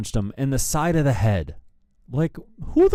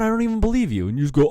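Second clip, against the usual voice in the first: ordinary delivery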